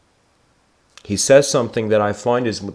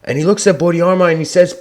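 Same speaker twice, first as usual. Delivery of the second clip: ordinary talk, nothing marked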